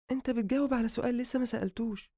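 reply quickly in a high female voice; high-pitched, quick retort; female character's voice. Acted. Narrator voices woman.

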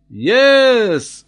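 high, loud exclamation; excited confirmation. A narrator's reading. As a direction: confirm it with a jubilant cry. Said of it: "said he".

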